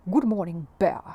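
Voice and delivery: a loud, gruff neighbour's hello; loud gruff neighbour greeting. A narrator's reading. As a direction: bark it out gruffly at the bear. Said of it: "he said gruffly".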